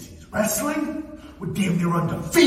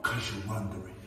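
ordinary talk, nothing marked

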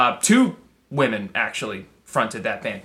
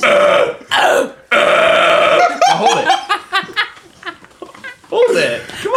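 excited; 5 dB louder than usual. Real, not acted. Coaching a held grunt.

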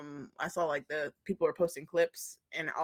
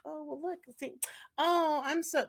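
mock sassy voice; 9 semitones higher than usual. Mocking a nitpicker, high.

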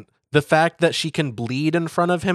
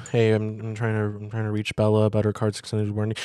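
rushed, softer warranty-call voice; mock telemarketer; 4 semitones lower, 4 dB quieter, faster than usual. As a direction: rattle it off like a spam call. [name] as robocaller.

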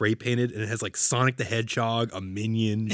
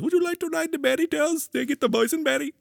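high, hammed-up Middle Eastern accent, inviting; mock Middle Eastern accent. Knott's Berry Farm ride host inviting guests, mock Middle Eastern accent. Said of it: "use his real accent".

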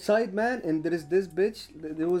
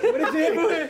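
laughing, pitched way up; high laughing confusion; 11 semitones higher, 4 dB louder than usual. Retold or imitated. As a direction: ask it through laughter, baffled. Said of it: "I'm like".

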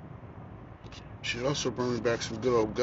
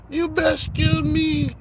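Shaft movie line; 6 dB louder, slower than usual. Retold or imitated. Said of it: "I remember that from people from Shaft".

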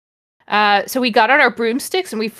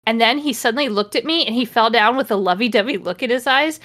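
ordinary delivery

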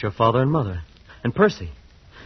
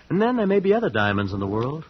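ordinary talk, nothing marked

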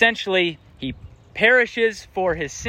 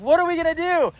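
high-pitched mock panic; freaking out; 10 semitones higher, faster than usual. Sailors in the storm asking each other, freaking out. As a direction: act out a panicked cry, pitched way up. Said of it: "they're freaking out".

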